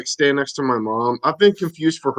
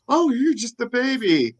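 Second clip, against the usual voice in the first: mock cooing; 9 semitones higher, 3 dB quieter than usual. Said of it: "she was like".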